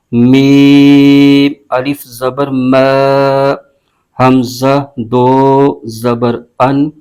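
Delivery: slow, drawn-out chanted spelling of letters and vowel marks; Quran spelling drill. Shown as a demonstration. Teacher spelling a Quran word for students, slow recitation chant. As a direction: chant each letter and mark slowly, like a tajweed teacher. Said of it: "اب اس کے ہجے یوں کریں گے".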